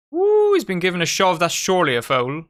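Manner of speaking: loud outburst opening on a high whoop; excited protest. Real, not acted. Streamer seeing a player shoved, real excited protest. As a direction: react live, calling for the foul.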